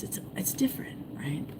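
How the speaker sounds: hushed stage whisper. Real, not acted. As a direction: whisper it like a secret. Hushed aside about fish fingerprints.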